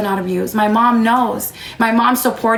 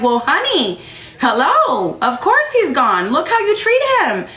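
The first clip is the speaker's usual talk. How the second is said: high, sarcastic imitation of mom scolding; mock scolding mom; 8 semitones higher than usual. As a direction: mimic mom rubbing it in, pitched up. Imagined mom scolding her sarcastically.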